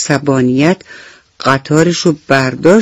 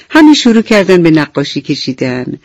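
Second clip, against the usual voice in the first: plain reading voice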